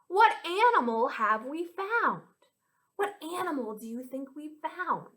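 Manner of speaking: put-on lilting children's rhyme with swooping pitch; rhyme recital. Teacher sharing a nighttime rhyme with children, lilting. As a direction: chant it with playful mystery. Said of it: "this rhyme goes like this".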